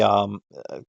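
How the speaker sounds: ordinary delivery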